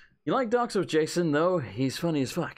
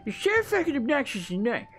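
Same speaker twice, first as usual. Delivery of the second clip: much higher, whiny mocking commenter; 4 semitones higher than usual. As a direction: sneer it like a petty troll. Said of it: "a bunch of comments like".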